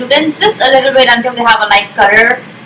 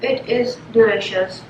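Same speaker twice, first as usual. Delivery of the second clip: eating crunchy fried chicken; 9 dB quieter than usual. Real, not acted. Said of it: "Let me take a bite of the chicken".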